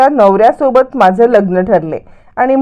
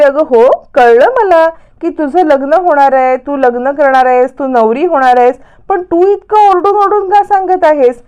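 pitched up, climbing to the last question; acted impatience, rising higher as it goes; exasperated retort; 5 semitones higher than usual. Sayali asks Priya why she keeps shouting, exasperated retort.

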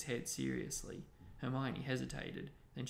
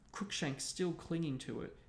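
usual speaking voice

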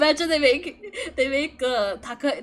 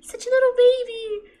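cooing; 8 semitones higher than usual. Real, not acted. Cooing at young character.

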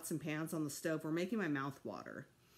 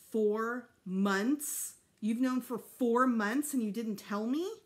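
louder indignant question; outraged boy; 4 semitones higher, 6 dB louder, slower than usual. A narrator's reading. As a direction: son accusing his parents, raised voice.

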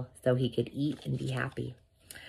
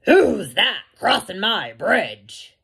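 loud, slow troll challenge; mean, mad troll; 5 semitones higher, 7 dB louder, slower than usual. A narrator's reading. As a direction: demand it like an angry bridge troll. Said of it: "the troll says".

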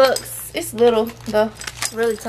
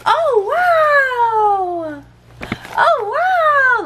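high, loud drawn-out wow imitating the child; mock amazement; 11 semitones higher, 4 dB louder, much slower than usual. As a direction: act amazed for the kid. Mimicking child's amazed reaction.

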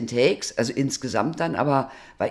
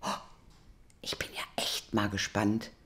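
hushed curious musing to herself; quiet anticipation in the car; whispered inner thought; 3 semitones lower, 10 dB quieter than usual. Retold or imitated. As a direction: murmur it like a secret thought. Retold morning thought.

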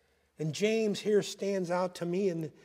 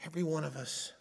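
quiet echo; 6 dB quieter than usual. Real, not acted. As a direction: let it sink in, said softly. Quiet echo after the shout.

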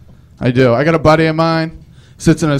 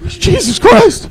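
high, drawn-out mock shock; startled outburst; much slower than usual. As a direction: cry out in comic alarm. Shocked reply to screeching GPS.

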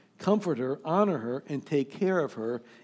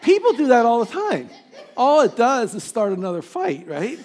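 大笑着说; laughing loudly; 8 semitones higher, 10 dB louder than usual. Real, not acted. Preacher laughing at habit.

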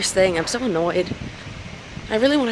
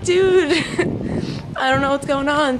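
excited; 6 semitones higher than usual. Retold or imitated. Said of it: "And I was like".

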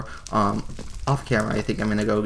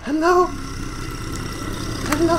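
shocked high hello. Real, not acted. Gamer facing the ogre breaking through, startled call. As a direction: squeak it out in alarm.

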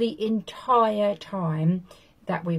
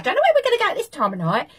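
high, fast squeaky complaint; squeaky woman voice; 9 semitones higher, 5 dB louder, faster than usual. Retold or imitated. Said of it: "squeaky little voice".

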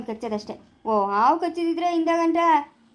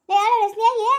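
calling out; 7 semitones higher, 5 dB louder than usual. Acted. Calling out to Snehi.

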